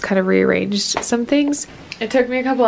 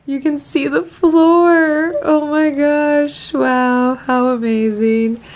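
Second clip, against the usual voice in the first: mock amazement; 5 semitones higher, 4 dB louder, much slower than usual. Showing off cleaned office.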